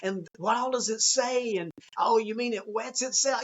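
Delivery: put-on high, lively enthusiasm matching a child; enthusiastic dad. Dad matching his child's excitement, high and eager. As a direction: gush over the doll like it's the best thing ever. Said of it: "you're like".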